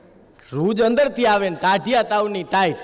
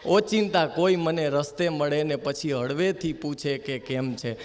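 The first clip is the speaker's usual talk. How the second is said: soft poem close; 7 semitones lower, 6 dB quieter than usual. Shown as a demonstration. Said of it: "ધ્રુવ ભટ્ટના શબ્દોથી કરું".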